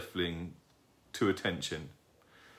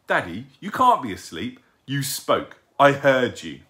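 lightly voiced owlet, higher and louder; insistent young owlet; 4 semitones higher, 8 dB louder than usual. A narrator's reading. Young owl to his sleeping daddy, insistent. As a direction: protest with emphasis as a child would.

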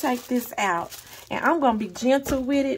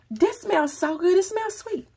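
higher, delighted sing-song; 8 semitones higher than usual. Real, not acted. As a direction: coo it up high with pleasure. Raving about smell.

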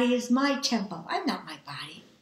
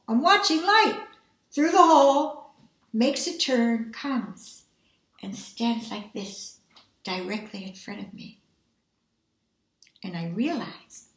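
usual speaking voice

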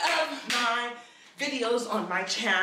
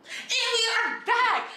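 high, drawn-out show-host return; big welcome back; 11 semitones higher, slower than usual. Pretend. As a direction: announce the comeback high and stretched out. Big return after the intro.

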